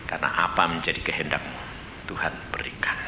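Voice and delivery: low, fading to a whisper; whispered promise. Acted. God promising the believer, low whisper. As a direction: breathe God's promise softly. Preacher voices God speaking.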